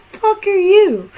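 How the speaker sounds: high, drawn-out bewildered demand; incredulous outburst. Acted. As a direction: blurt it out high, stretching the words. Acted reaction to game greeting.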